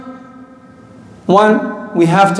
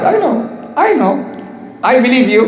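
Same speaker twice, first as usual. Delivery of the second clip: 1 sympathetic; 5 semitones higher than usual. Real, not acted. Sympathising with fasting youth.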